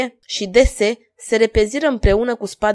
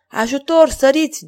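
high, raised cry for help; moaned plea; 7 semitones higher, 5 dB louder than usual. A narrator's reading. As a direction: plead for rescue, voice pitched up. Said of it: "gemu Gillette".

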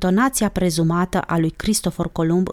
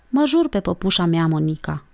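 ordinary delivery

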